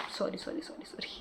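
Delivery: soft, breathy rapid apologies; whispered sorry. Real, not acted. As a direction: whisper the sorries under your breath. Quick whispered apology to herself.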